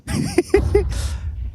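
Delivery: giggling falsetto laugh; high squealing laugh. Real, not acted. Laughs at the joke.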